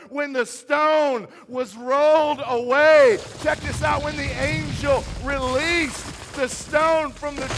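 shouted high, every big word held long; thunderous preacher's climax; full-force preaching. Real, not acted. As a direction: roar out the story to the whole congregation. Sermon climax over sound effect.

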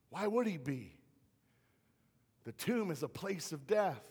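normal talking voice

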